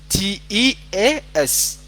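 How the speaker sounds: slow "T E A S", each letter separate; spelling out the correction. Shown as a demonstration. Teacher gives the correct spelling, slowly spelt "T E A S". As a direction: spell "T E A S" out deliberately. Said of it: "Tea waa T E A S".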